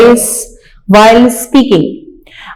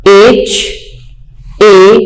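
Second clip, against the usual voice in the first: ordinary delivery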